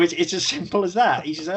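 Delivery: ordinary talk, nothing marked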